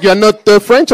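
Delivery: loud imitated French accent. French barista questioning the customer, loud French accent. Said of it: "he was like".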